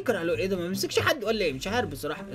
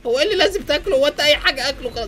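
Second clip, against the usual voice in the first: incredulous shout; 7 semitones higher, 8 dB louder than usual. Real, not acted. Incredulous at friend's eating.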